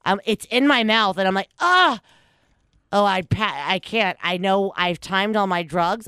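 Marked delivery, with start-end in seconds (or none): 1.6-2.0 disgusted yelp. Retold or imitated. Spitting out cat treats in disgust.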